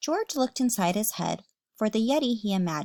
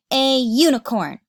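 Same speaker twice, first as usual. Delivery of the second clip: much louder, higher proud cry; excited reveal; 5 semitones higher, 10 dB louder than usual. Acted. George tells mom his wish, excited cry. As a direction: burst out with the dream.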